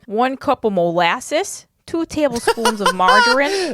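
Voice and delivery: mock Midwest accent. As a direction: attempt a regional accent that lands as Midwest. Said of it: "That's a good Midwest".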